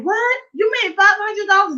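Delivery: imitating a shocked husband's high squeak; stunned husband. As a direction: react like your wife just said something impossible. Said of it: "he was like".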